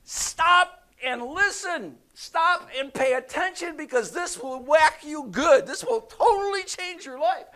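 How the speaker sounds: loud, high preacher shout; emphatic God's command. Acted. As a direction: demand their full attention at full volume. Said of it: "God's trying to say".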